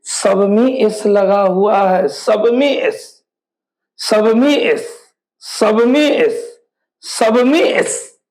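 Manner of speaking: slow repeated insistence; slow emphatic. Shown as a demonstration. Teacher to students, slow repeated reminder. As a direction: repeat it slowly so it sinks in. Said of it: "ध्यान से".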